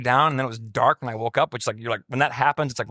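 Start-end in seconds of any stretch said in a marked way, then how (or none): none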